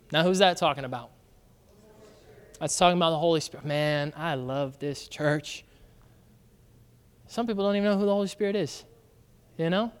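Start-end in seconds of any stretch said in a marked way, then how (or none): none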